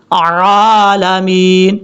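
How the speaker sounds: very slow 'عالمین' from the throat; clear ain. Shown as a demonstration. Teacher makes the ain clear, slow correct recitation. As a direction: hold the ع of 'عالمین' long and strong. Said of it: "عین کو clear کر کے".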